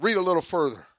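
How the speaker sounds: loud, high commanding proclamation; voice of God. Retold or imitated. Preacher voicing God calling him to preach, loud and commanding. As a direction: boom out God's command.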